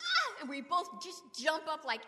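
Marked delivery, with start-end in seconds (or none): 0.1-0.4 high, loud startled cry; sudden realization. Retold or imitated. Singer suddenly realizes what Swami meant. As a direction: gasp as it dawns. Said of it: "and I went".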